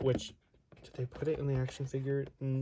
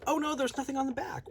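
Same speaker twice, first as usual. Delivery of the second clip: mock dismay; 12 semitones higher than usual. Real, not acted. Finds box back blank.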